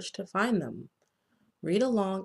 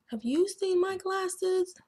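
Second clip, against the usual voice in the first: even higher, pleading little bookworm voice; worried bookworm asking; 11 semitones higher than usual. Acted. Bookworm asking again about his glasses, higher and pleading. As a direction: ask again, higher and more urgent. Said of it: "Carney asks".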